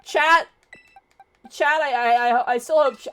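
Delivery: loud, high plea to chat; calling to chat. Real, not acted. Streamer pleads with the chat in a loud, high rush.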